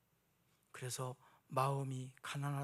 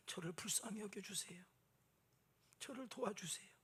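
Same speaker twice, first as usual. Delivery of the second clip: softer whispered "저를 불쌍히 여겨주세요" like a tearful tax collector; whispered plea; 3 semitones higher, 9 dB quieter than usual. Retold or imitated. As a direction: breathe the plea, ashamed to speak aloud. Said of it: "큰 소리로도 말 못하고".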